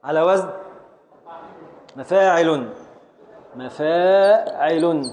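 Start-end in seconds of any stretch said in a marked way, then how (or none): 3.6-5.0 slow meter foot. Shown as a demonstration. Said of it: "بحذف الخامس الساكن".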